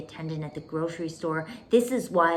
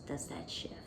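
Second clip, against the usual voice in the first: whispered question; 11 semitones lower, 10 dB quieter than usual. Shown as a demonstration. Coach asking students if the energy shifts, whispered. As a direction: whisper it much softer and lower.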